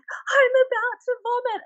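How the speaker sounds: high, slow mock recruit whine; whiny recruit. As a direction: imitate a recruit pleading that he's about to vomit. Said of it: "a recruit would be like".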